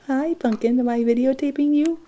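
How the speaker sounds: high singsong cooing. Real, not acted. Cooing to baby.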